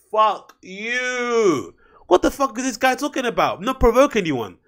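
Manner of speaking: high, incredulous outburst; outraged disbelief. Real, not acted. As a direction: pitch up in angry disbelief. Outraged rant at Bonucci's quote.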